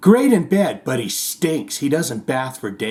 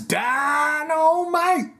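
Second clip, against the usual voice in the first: much higher, very slow drawn-out Dynamite; J J Walker catchphrase; 13 semitones higher, much slower than usual. Retold or imitated. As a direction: stretch out Dynamite like a sitcom catchphrase. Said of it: "J J Walker's catchphrase".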